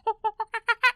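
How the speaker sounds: very high chimp hoots; chimpanzee hooting. Acted. Podcaster imitating the chimpanzee at the zoo, high hooting. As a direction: hoot rapidly like an excited chimpanzee. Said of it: "the chimpanzee just goes".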